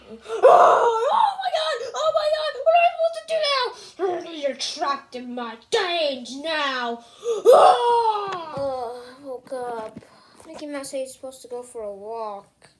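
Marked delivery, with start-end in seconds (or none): 0.1-4.3 high, loud cries of panic; panicked screaming. Acted. Mickey Mouse caught by the cat, screaming in panic. But I heard some screaming. 7.2-8.5 sharp high gasp with a cry in it; panicked gasp. Acted. Trapped mouse gasps in fright at the cat.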